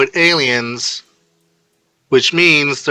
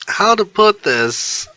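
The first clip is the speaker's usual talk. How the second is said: hesitant; 10 semitones higher, much slower than usual. Acted. Kirishima hesitant.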